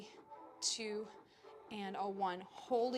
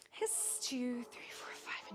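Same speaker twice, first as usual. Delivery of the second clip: rhythmic shushing; 2 semitones higher, 7 dB quieter, slower than usual. Shown as a demonstration. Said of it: "loud shish".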